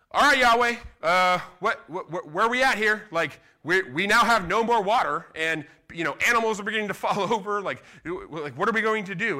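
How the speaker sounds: rising panic, loud and rushed; frantic plea. Pretend. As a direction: plead with God, nearly frantic. Frantic plea to God, day two.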